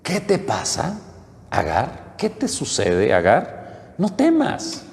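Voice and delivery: a light hint of an angel's voice, gentle questioning; angel calling Agar. Angel from heaven calling to the weeping Agar, lightly voiced and gently questioning. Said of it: "el ángel de Dios la llama desde el cielo y le dice".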